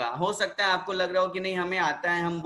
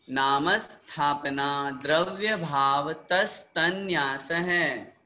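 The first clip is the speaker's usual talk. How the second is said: sutra recitation; slower than usual. Shown as a demonstration. Teacher recites sutras for repetition.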